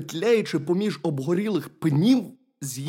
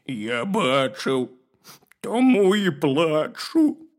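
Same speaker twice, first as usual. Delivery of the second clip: slow, drawn-out bear whine; tearful bear cub; 4 dB louder, slower than usual. A narrator's reading. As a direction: stretch it out as a weepy bear cub. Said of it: "ведмедик заплакав".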